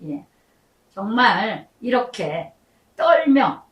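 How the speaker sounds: ordinary talk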